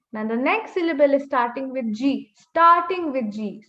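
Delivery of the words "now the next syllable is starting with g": ordinary talk, nothing marked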